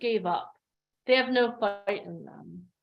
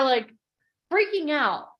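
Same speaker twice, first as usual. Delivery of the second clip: ordinary delivery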